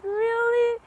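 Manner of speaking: put-on high, disbelieving really from the girl; hopeful girl. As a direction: lift it up high like a surprised young girl.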